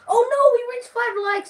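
high, loud fake alarm; mock panic. Real, not acted. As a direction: squeal it like a disaster. Mock dismay at five likes.

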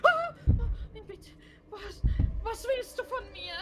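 high, loud scared cry; frightened outcry. Real, not acted. Player attacked by a creature, frightened cry. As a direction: yelp in terror at the attacker.

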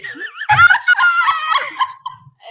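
loud, high burst of laughter; shrieking laugh; big laugh. Real, not acted. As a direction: crack up helplessly. Cracks up at the book's plot.

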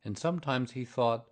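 plain reading voice

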